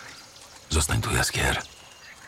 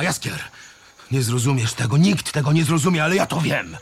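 ordinary delivery, nothing marked

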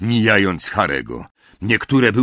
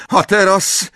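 higher, louder incantation; shouting spell; 10 semitones higher, 6 dB louder than usual. Acted. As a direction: cry the spell out. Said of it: "krzyknęła profesor McGonagall".

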